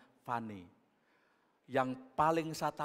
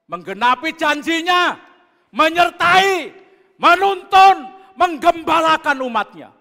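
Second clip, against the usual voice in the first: preaching at full force; 12 semitones higher, 19 dB louder than usual. Acted. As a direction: lift each word higher to the rafters. (seruan khotbah yang lantang dan tinggi)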